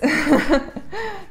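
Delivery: a bright burst of real laughter; delighted laugh. Real, not acted. Asked about travel, she laughs with delight.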